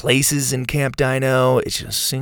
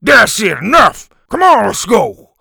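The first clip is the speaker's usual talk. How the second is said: loud, higher commanding shout; teacher shouting; 8 semitones higher, 9 dB louder than usual. A narrator's reading. Teacher stopping the fight, loud commanding shout. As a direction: end the fight with a bark.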